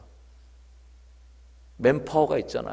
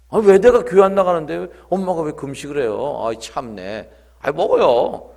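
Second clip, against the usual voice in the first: put-on louder, higher, annoyed teenage son; irritated protest; 3 semitones higher, 8 dB louder than usual. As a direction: complain to your mother with irritation. Son protests at mother's fasting. (짜증 섞인 항변)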